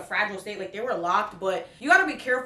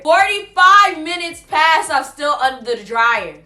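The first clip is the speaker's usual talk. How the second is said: starts loud and gets louder, dragging forty-five out slowly; building to a shout; 3 semitones higher, 11 dB louder than usual. Retold or imitated. Vlogger shouting the minutes she sat under the dryer, building exasperated yell. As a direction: yell the time like it's unbearable.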